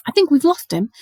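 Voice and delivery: a touch out of breath; panting. A narrator's reading. Harry to friends after fleeing, panting. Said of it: "panted, leaning against the cold wall".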